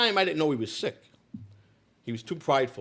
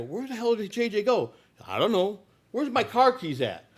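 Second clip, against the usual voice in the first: alarmed question; 10 semitones higher than usual. Retold or imitated. Narrator asks where J J went.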